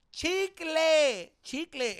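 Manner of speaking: high-pitched mock yell of a drunk woman; drunk girl yelling. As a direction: shriek the word like a drunk heckler. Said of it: "she s-, yelled it again".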